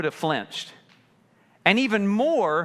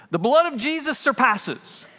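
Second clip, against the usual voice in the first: mock believer; 7 semitones higher, 5 dB louder than usual. Mocking a careless believer.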